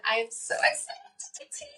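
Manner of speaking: high, breathy unvoiced whisper; excited whisper. Real, not acted. Telling listeners her excitement in a high whisper.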